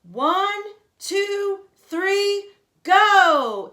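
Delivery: high, loud countdown building to go; booming countdown, loudest on go; countdown shout. Acted. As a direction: yell the start of the race. Countdown at race start.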